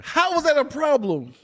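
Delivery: high, loud protesting question; indignant disbelief. Real, not acted. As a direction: push back loudly, incredulous. Repeats protest at being criticised.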